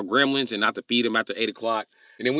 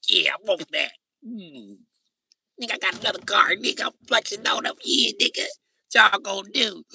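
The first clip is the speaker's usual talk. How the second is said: high-pitched excited bragging, imitating a younger self; excited boast; 12 semitones higher, 4 dB louder than usual. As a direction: crow about the new car. Retold excited boast over a car.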